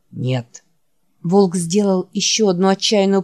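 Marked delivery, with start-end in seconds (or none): 0.1-0.6 low, soft, worn-out creature voice; barely has the strength to say the name; weary dying Wolf. Acted. Wounded Wolf calling his friend Jack, low and faint. Wolf's voice.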